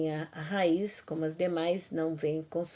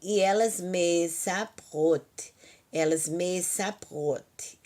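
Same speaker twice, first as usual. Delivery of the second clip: slow drill; slower than usual. Shown as a demonstration. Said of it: "teacher drills verb forms".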